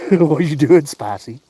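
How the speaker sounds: high, loud burst at the cats; loud excited call. Real, not acted. Man yelling happily at his scrambling cats, high and loud. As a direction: shout it out high in delight. Cat scramble, laughing owner.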